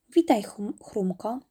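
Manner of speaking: plain reading voice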